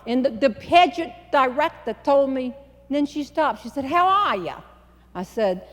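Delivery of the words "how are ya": higher New York greeting; brash Long Island hello; Northern city accent. Retold or imitated. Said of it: "big city Northern accent".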